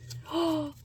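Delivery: sharp intake of breath in delight; quick thrilled gasp. Real, not acted. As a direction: gasp at the surprise inside. Delighted gasp opening mail.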